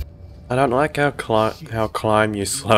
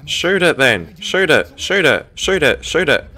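raised, insistent repeated command; urgent urging; 4 semitones higher, 3 dB louder than usual. Real, not acted. Player urging a game character to shoot, urgent. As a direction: egg them on, pitched up and insistent.

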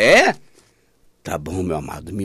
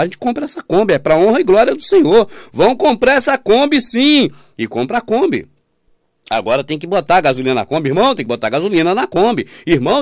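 usual speaking voice

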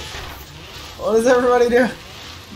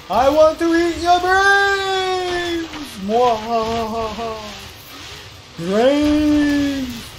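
zombie voice; 7 semitones higher than usual. Pretend. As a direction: moan for brains like a cartoon zombie. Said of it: "I'm a zombie".

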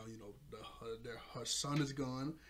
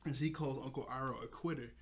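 ordinary talk

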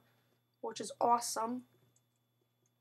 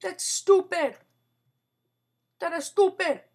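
annoyed; 5 semitones higher, 8 dB louder than usual. Real, not acted.

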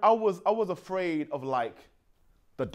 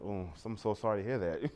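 mock condolence; 12 semitones lower, 14 dB quieter than usual. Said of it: "You're like".

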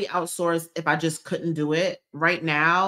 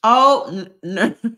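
ordinary delivery

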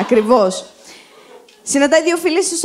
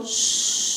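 soft hiss of a secretive shush; quiet conspiratorial shush; 9 dB quieter than usual. Acted. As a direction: hush them as if sharing a secret. Shushing the audience before the secret.

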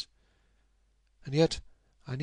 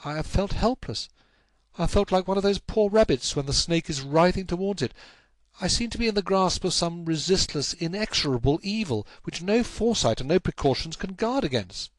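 higher-pitched, despairing confession; helpless despair; 8 semitones higher than usual. Acted.

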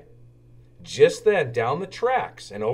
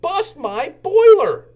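much higher, louder burst of exasperation; little engine exasperated, much higher and louder; puffed exclamation; 9 dB louder than usual. Acted. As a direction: blurt it out, startling the owl. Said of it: "Thomas puffed".

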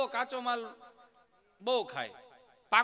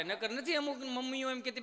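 ordinary delivery

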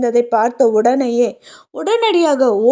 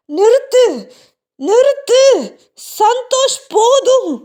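desperate shouting; 9 semitones higher than usual. Acted. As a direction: scream at the laughter, at the end of his rope. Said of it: "சத்தம் போட ஆரம்பித்தார்". (பதற்றமான கூச்சல்)

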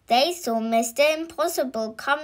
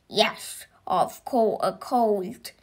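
slow, sullen grumpy character voice; grumbling; slower than usual. A narrator's reading. Mister Grumble answers Mister Happy at the lake, grumbling. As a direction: grumble it out slowly. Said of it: "grumbled Mister Grumble".

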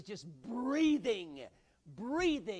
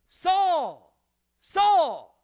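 much higher and louder cry of the name; heavenly shout; 11 semitones higher, 18 dB louder than usual. Acted. Voice from heaven waking the sleeping Paul, shouting.

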